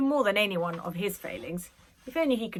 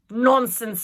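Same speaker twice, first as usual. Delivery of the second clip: scoffing; 4 semitones higher, 11 dB louder than usual. A narrator's reading. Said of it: "scoffed Nanny".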